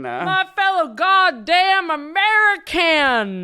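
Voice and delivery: slow, high, hammy patriot yell; mock patriotic voice. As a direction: shout it like a flag-waver.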